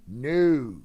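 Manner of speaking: sharp refusal. Retold or imitated. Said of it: "He's like".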